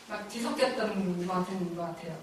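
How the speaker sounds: ordinary talk, nothing marked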